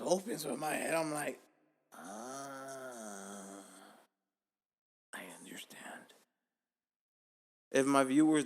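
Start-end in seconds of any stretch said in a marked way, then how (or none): 1.9-6.1 drawn-out ah, then a whisper; hushed realization. Retold or imitated. Man realizing why the urinals are empty, hushed realization. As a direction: let it dawn softly, then whisper.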